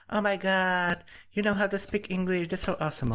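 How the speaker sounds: high, gushing mock-friend voice; lightly voiced friend, high and gushing. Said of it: "my friends is like".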